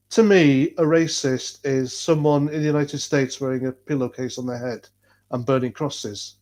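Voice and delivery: ordinary talk, nothing marked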